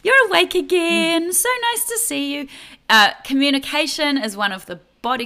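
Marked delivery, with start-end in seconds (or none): 0.0-2.5 high, loud sing-out with drawn-out words; mock bursting in on a baby, far too chirpy; over-excited greeting. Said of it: "not gonna go bursting in and go".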